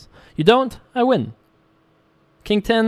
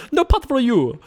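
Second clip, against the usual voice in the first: quicker, much higher refusal; faster soup Nazi; 7 semitones higher than usual. Acted. Streamer redoing the Soup Nazi line quicker, mock stern. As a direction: snap it out like the soup seller. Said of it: "That was too slow".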